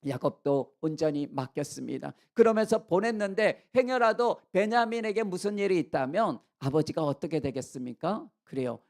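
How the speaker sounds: usual speaking voice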